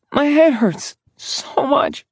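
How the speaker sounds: put-on high, slow, hurting girl's voice; pained girl. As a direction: ache through each word. Pained girl answers him.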